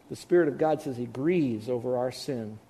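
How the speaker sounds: ordinary delivery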